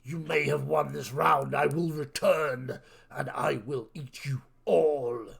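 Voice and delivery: loud, slow, booming creature threat; menacing monster. Acted. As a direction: bellow a villain's parting vow, drawing out the words. Game master voices departing monster's threat.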